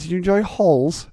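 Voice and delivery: "halls" clipped short in high teacher voice; mock abbreviation. Teacher abbreviating holidays to "halls", high and mocking. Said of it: "repeat the sentence with an abbreviation".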